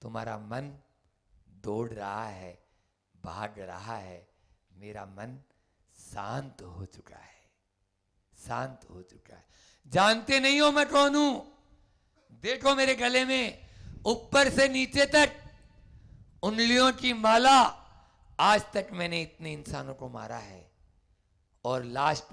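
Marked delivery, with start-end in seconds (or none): 0.0-9.5 calm quiet. Acted. The Buddha softly calming the bandit's mind. 9.9-20.7 higher, louder threat; menacing boast. Acted. Bandit threatening the Buddha, shouting.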